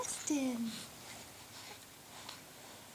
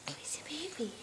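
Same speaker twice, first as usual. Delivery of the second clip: whisper; 8 dB quieter, much faster than usual. Real, not acted. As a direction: whisper it like a secret game. Mother whispering to baby.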